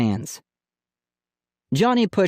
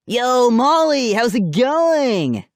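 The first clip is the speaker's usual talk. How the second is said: loud drawled greeting; 12 semitones higher, 4 dB louder, much slower than usual. A narrator's reading. Teen cousin's loud greeting.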